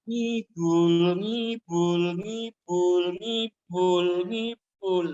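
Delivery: high wailing siren sound, repeated; siren imitation. Preacher mimics the skinny angel's siren, high wailing. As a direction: wail it like a siren. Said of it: "Tahu sirinenya bunyinya apa?".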